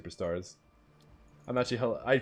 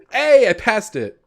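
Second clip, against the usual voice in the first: slow, high, loud cheer; delighted exclamation; 8 semitones higher, 8 dB louder, slower than usual. Real, not acted. Player on passing it, loud pleased exclamation. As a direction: call out the win with drawn-out glee.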